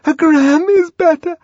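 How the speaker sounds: high, loud panicky voice; distressed high-pitched appeal; frightened pleading. Acted. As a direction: blurt it out while being shaken. Shaken woman starts to plead.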